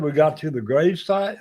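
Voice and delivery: ordinary delivery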